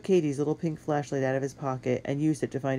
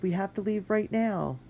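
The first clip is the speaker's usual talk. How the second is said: put-on higher, pressing boy's voice; urging; 7 semitones higher than usual. A narrator's reading. Boy urging his wounded friend to leave, higher and insistent. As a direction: press him to get moving. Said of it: "Matt urged".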